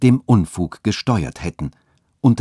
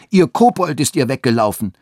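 higher-pitched taunt from a crowd; jeering call; 5 semitones higher, 4 dB louder than usual. A narrator's reading. Boys chasing old woman and goat, jeering call. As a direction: call it out like a mocking street boy. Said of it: "Andere riefen".

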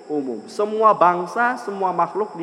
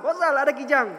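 high-pitched quick alert; urgent alert; 9 semitones higher than usual. Acted. Hunter alerting his friend to a deer, acted urgent high call. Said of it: "langsung ngomong".

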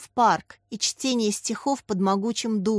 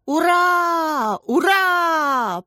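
high, loud drawn-out hurrah; long ringing shout; echoing cheer; 8 semitones higher, 5 dB louder, much slower than usual. A narrator's reading. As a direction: cheer like a whole floor of schoolchildren. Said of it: "прокатилось эхом по первому этажу".

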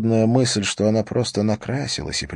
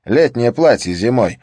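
surprised colleague; 5 semitones higher, 6 dB louder than usual. Acted. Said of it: "заметил Скарре".

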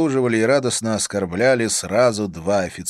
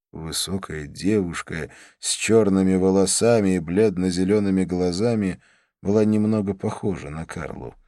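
plain reading voice

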